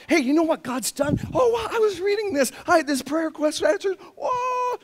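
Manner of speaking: high, eager gushing chatter; excited churchgoer. Pretend. Pastor playing an excited churchgoer, high and gushing. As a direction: gush about answered prayers.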